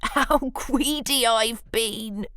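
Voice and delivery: spluttering through a giggle; giggling. Acted. Bruce giggling as he admits his greed. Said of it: "spluttered Bruce with a giggle".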